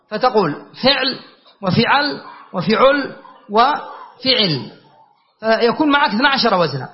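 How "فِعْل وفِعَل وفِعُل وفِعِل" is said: فعل four ways: "fiʕl, fiʕal, fiʕul, fiʕil"; i on first letter. Shown as a demonstration. Teacher listing i-first patterns, "fiʕl, fiʕal, fiʕul, fiʕil". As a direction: keep i after f, change the middle vowel each time. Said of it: "الفاء المكسورة".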